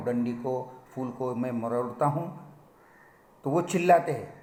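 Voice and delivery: usual speaking voice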